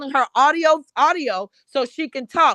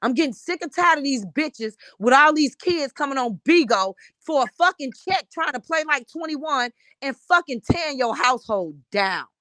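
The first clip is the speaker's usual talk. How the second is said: normal talking voice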